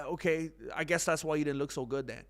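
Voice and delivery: ordinary talk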